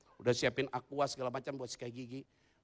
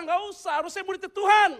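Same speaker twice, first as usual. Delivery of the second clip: normal talking voice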